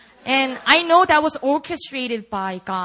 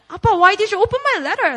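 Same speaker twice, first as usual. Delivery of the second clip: mock outraged; 8 semitones higher, 8 dB louder, much faster than usual. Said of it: "he was like".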